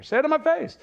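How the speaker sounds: high-pitched, half-hearted holler; mild mock yell. Speaker echoing the family catchphrase, mild mock yell. Tosses the yelled catchphrase in once more.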